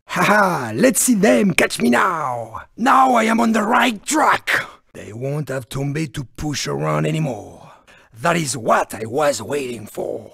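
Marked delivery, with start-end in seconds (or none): 4.9-10.3 low, soft villainous satisfaction; hushed, sinister triumph; quiet gloating. Acted.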